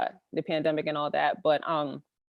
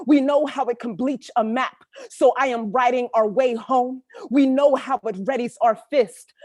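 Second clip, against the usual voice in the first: rising, urgent spoken-word delivery, louder than her chat; spoken word poem; 6 semitones higher, 8 dB louder than usual. Acted. Poet performing a march poem for her Zoom audience, fierce spoken word. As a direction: preach the poem like a rallying cry at a march.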